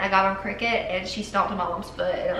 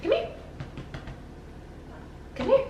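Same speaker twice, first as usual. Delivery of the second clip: calling the puppy; 5 dB quieter than usual. Real, not acted. Calling the new puppy over.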